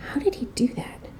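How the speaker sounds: soft, unvoiced whisper of wonder; awed whisper. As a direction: breathe it like you can't believe it. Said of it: "you'll sit quietly".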